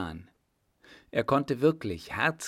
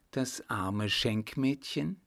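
usual speaking voice

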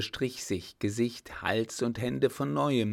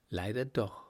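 plain reading voice

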